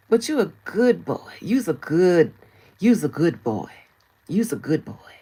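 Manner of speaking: loud, mocking good-dog praise. Commentator taunts a blogger as master's pet, mocking praise. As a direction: praise them like an obedient pet.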